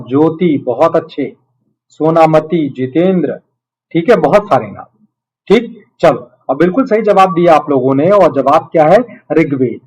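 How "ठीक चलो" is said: ordinary talk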